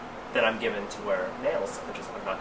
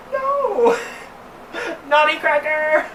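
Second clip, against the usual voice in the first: high shrill scolding; mock scolding; 4 dB louder, slower than usual. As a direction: scold like a shocked auntie.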